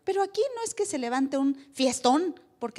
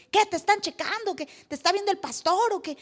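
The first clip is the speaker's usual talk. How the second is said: higher mocking friend; teasing friend; 6 semitones higher than usual. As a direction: tease him about the pastor. (amigo burlón, un poco más agudo)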